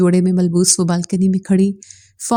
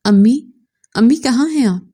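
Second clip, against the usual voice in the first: crying out; 3 semitones higher than usual. Acted. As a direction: call out for mother in alarm. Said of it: "बुलंद आवाज़ में चिल्लाई". (सीढ़ियाँ उतरते हुए अम्मी को ज़ोर से पुकारना)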